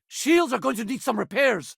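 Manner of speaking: high-pitched urgent battle report; combat callout. Acted. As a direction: call it out mid-fight, pitched high. Combat status call.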